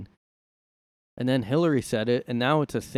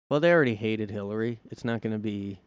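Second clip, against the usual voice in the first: normal talking voice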